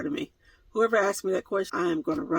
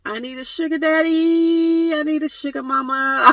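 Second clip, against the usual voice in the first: comic plea; 8 semitones higher, 5 dB louder than usual. Pretend. Comic pleading.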